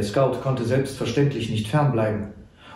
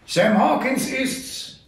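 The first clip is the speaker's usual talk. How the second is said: put-on old trapper; 10 semitones higher, slower than usual. A narrator's reading. Old trapper answers the guard.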